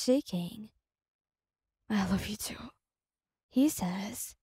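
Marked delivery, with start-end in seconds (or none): 1.9-2.7 choked voice. A narrator's reading. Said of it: "his voice choked with emotion".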